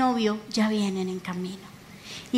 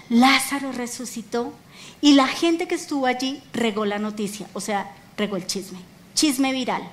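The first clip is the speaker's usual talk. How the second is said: ordinary talk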